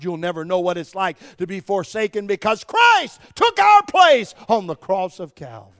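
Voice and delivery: preaching, building to a shout. Real, not acted. Preacher rebuking complaining Christians, building to a roar. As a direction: start firm, climb steadily to a high, full-throated shout.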